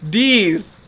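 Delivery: "D's" as a drawled-out mobster "these"; hard D in place of TH, "D's"; mocking "deez". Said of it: "might as well have just spelled it D E E Z".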